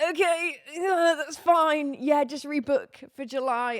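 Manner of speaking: high, loud, slow, upset "it's fine" voice; forced acceptance. Retold or imitated. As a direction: act out agreeing while clearly upset. Acting out her strained acceptance.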